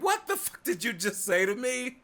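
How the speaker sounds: high-pitched incredulous demand; hammed-up high confusion; mock affronted disbelief. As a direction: react as if hearing total nonsense. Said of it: "you would be like".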